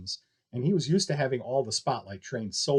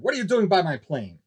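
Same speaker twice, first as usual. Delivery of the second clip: shouted; 6 semitones higher, 4 dB louder than usual. A narrator's reading. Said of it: "she shouted".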